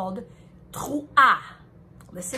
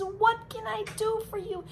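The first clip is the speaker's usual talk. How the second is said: higher, caring, reassuring voice; loving parent; 9 semitones higher than usual. Acted. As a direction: comfort a child tenderly. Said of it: "Hashem comes running and says".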